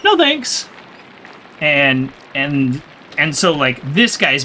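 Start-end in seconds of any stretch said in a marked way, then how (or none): 0.0-0.7 high, mock-polite "No, thanks."; a squeaky, sing-song no thanks; polite refusal. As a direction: chirp a bright refusal. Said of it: "I clearly was like".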